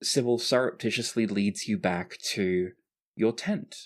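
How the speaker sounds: usual speaking voice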